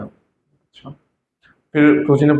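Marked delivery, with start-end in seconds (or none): 0.0-0.9 breathless unvoiced acha; a shocked, deflated whisper; stunned whisper. Caught out by his mentor, a stunned whispered acha. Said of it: "मैंने बताया".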